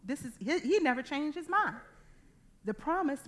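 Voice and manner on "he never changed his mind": normal talking voice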